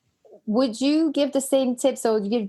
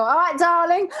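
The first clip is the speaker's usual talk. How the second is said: Cockney market trader, higher and louder; cheery Cockney hello, pitched up; Cockney greeting; 8 semitones higher, 4 dB louder than usual. Retold or imitated. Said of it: "you have that really Cockney accent".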